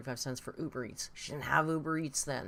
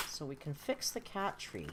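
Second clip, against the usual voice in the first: usual speaking voice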